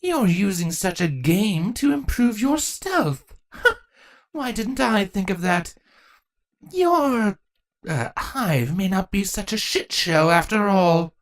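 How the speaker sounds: slow amused approval; grinning, impressed. A narrator's reading. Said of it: "impressed grin".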